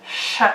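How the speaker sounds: sh said as a long hushed hiss; teaching the sh sound slowly. Shown as a demonstration. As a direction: blow out a steady sh. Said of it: "this new S H sound, or".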